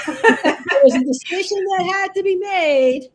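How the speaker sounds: words bubbling through real laughter; laughing. Real, not acted. Host laughing while conceding the point to her guest. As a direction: laugh as you admit it. Laughing over the mantra joke.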